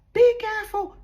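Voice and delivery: sudden high, loud warning call; warning shout. Shown as a demonstration. Parent shouting as the child crosses the road, urgent warning. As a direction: call out a danger warning, pitch leaping up. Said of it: "your child crosses the road and you shout".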